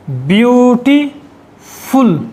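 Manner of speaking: "ब्यूटीफुल" said very slowly, its parts drawn out with a falling tune; slow two-part word. Shown as a demonstration. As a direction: stretch "ब्यूटीफुल" so both parts are heard. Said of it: "जिस लफ्ज़ में दो part". ("ब्यूटीफुल" धीरे-धीरे, दोनों हिस्से अलग सुनाते हुए)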